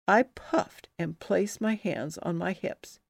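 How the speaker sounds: ordinary delivery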